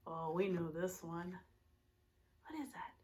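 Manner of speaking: ordinary talk, nothing marked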